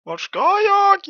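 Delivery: high, loud desperate cry; panicked shriek. Real, not acted. Gamer lost while chased, shrieking in panic.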